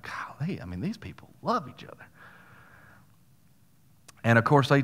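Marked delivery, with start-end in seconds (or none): 0.0-2.0 very soft, marvelling aside; hushed awe. Retold or imitated. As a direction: murmur it in moved amazement. Moved to tears, retelling his awe.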